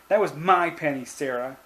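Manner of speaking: put-on storybook shout of a wronged boy; angry shout. A narrator's reading. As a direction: cry it out in accusation, short of full yelling. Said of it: "he shouted".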